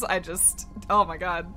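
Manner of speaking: usual speaking voice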